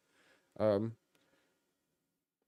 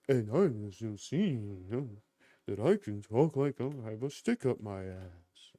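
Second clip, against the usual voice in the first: pompous voice; 3 semitones lower, slower than usual. Shown as a demonstration. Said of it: "I can't do, you know, impersonation very well".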